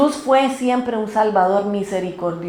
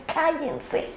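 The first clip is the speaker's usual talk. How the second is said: soft, high-pitched imitation of a scolding crowd; mock scolding hush; 4 semitones higher, 10 dB quieter than usual. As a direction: hush them in a small, high voice. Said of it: "Los empezaron a regañar".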